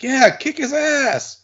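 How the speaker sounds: high, slow mock fan cheer; imitating excited fan. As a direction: drag it out like a dopey fan yelling. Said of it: "he was, like, jumping up, like".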